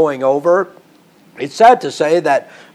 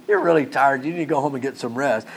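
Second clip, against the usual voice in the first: lightly mock a kindly send-home, dropping quieter; mock-gentle Paul; 2 semitones lower, 4 dB quieter than usual. Preacher repeats what Paul never said to Eutychus, mock-gentle. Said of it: "Paul saying anything like that".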